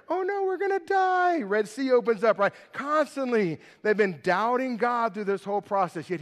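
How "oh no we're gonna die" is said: high-pitched whiny mock panic; put-on voice of exaggerated dread; mock panicked grumbling. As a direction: imitate a hammed-up complainer, pitched way up. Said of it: "Then they grumble about water".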